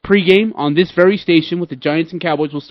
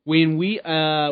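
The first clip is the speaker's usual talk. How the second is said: ordinary talk, nothing marked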